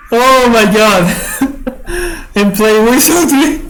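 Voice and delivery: high-pitched words breaking into laughs; real laughter. Real, not acted. Player overcome by a brutal winning turn, laughing. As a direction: talk through helpless giggles. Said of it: "I'm laughing".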